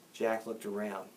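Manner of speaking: plain reading voice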